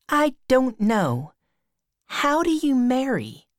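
put-on high, innocent questioning girl; Becky, curious schoolgirl. A narrator's reading. Becky asking Tom how one marries, high curious girl's voice.

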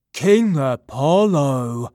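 loud, slow, eager hail; excited cry. Acted. Silenus hails Apollo to show him the thief, loud and excited. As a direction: call out to the king with glee. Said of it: "Silenus cried excitedly".

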